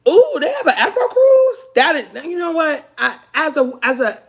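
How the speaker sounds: squealing high and loud with a laugh; delighted surprise. Real, not acted. Reading chat and spotting an Afro cruise, squealing with delight. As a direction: gasp with excitement at the news.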